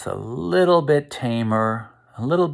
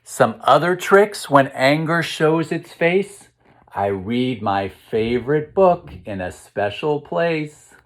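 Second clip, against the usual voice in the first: bouncy storytime rhyme; reading to children; 5 semitones higher than usual. A narrator's reading. Teacher reading the next page to the kids, storytime rhythm. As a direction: lift the first line, settle into the rhyme. Reads rhyming picture book aloud.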